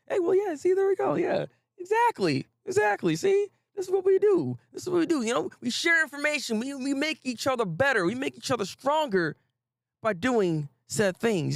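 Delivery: excited high greeting. Real, not acted.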